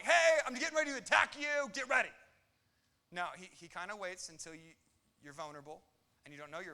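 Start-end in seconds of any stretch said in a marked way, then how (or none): 0.0-2.1 high, loud mock announcement; a lion politely announcing its attack; cheery warning. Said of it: "it's never like".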